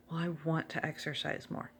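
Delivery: quiet, unsure wanting; meek hedging. Shown as a demonstration. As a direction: shrink into a timid maybe. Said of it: "No, I will exercise".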